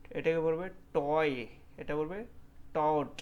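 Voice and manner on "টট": ordinary talk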